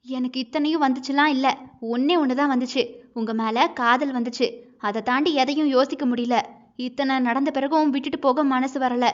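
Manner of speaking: usual speaking voice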